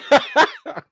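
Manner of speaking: high, loud cackling burst; real guilty laugh. Real, not acted. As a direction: laugh helplessly against your better judgment. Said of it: "I shouldn't laugh".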